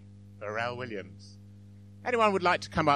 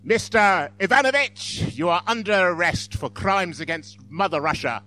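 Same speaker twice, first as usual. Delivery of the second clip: KGB officer; 3 semitones higher, 6 dB louder than usual. Acted. KGB at the door arresting someone, mock-official proclamation. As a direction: announce it like a stage secret policeman. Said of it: "and them saying".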